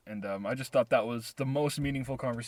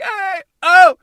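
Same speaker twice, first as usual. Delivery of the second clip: high, very loud back-and-forth hollers; mimicked shouted greetings; 17 dB louder than usual. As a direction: mimic two guys calling across a lot. Retold calls back and forth.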